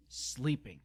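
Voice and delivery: ordinary delivery, nothing marked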